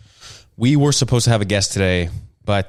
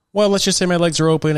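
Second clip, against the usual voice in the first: higher, teasing repeat; playful higher retake; 10 semitones higher, 3 dB louder than usual. Shown as a demonstration. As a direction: say it again, higher and coy.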